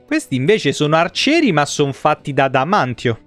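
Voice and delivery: usual speaking voice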